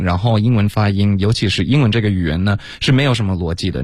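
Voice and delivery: ordinary delivery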